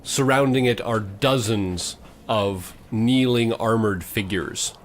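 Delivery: usual speaking voice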